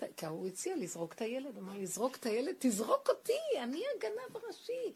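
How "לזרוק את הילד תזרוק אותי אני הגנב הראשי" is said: loud, high, impassioned imitation; retold shouting to God. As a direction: act out a rabbi crying out to heaven, surging louder. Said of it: "אז הוא התחיל לצעוק להשם".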